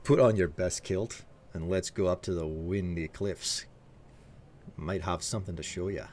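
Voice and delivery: a hint of a Scottish charmer, coaxing; Scottish accent, low and flirty for chat; Scottish flirt. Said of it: "Let's hear a flirt in the accent".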